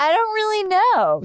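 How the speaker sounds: high-pitched, lightly mimicked Southern girl's voice; imitating the shop girl. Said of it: "she was like".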